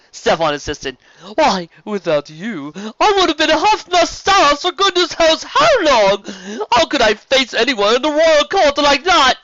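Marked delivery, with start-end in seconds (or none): 1.4-9.4 much higher, louder theatrical duke voice; over-the-top friendly serpent duke; flamboyant sea serpent. Acted. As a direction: gush like a warm, showy nobleman. Serpent laments mustache.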